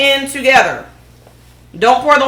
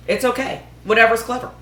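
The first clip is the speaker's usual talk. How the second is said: ordinary delivery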